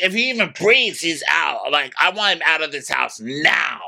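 high, worked-up imitation of yelling; mock outraged yell. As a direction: demand it high, like a fuming guy. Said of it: "Craig is downstairs yelling".

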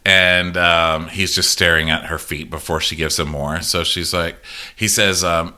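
ordinary talk

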